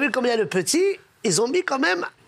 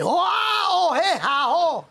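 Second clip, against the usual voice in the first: dying cry; 9 semitones higher, slower than usual. Acted. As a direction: choke out a final wail. Dying Japanese friend's last words.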